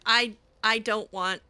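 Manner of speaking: ordinary talk, nothing marked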